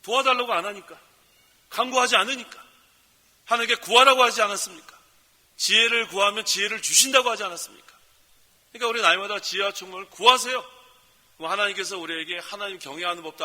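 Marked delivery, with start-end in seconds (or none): 0.0-10.8 loud, rising sermon voice; fervent preaching. Acted. Pastor rouses the congregation to trust God, full-force preaching. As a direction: preach it out with conviction.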